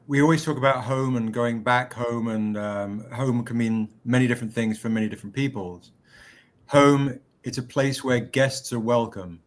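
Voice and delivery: low, measured passage reading; reading aloud. Acted. Reads a passage from the book.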